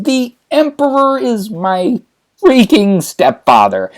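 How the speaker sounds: high, slow, incredulous outrage; outraged. Acted. Son appalled at mother's marriage, high outrage. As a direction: stretch every word in high-pitched disbelief.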